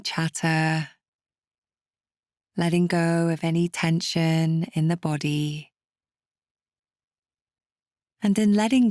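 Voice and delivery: calm, unhurried meditation guide, with long pauses; guided meditation. Acted. Meditation teacher settling listeners to calm political worries, calm and measured. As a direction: ease the listener gently through each step.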